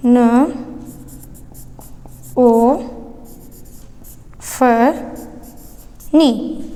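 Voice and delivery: each syllable alone, long pauses between; syllable-by-syllable dictation. Shown as a demonstration. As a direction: dictate it very slowly, one drawn-out sound at a time. Teacher dictates mnemonic syllable by syllable. (एक-एक अक्षर रुक-रुक कर)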